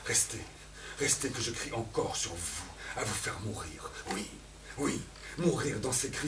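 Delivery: ordinary talk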